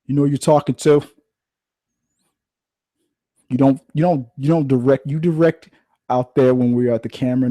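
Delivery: hammed-up star actor telling off the producer; mock diva outburst. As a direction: play the offended star snapping back. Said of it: "It's like".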